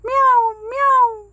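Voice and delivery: high, loud sung meows; singsong meowing. Acted. Kitten singing its found mother tongue, high singsong meows. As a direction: kitten happily singing mew, mew. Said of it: "అని పాడుకుంటూ".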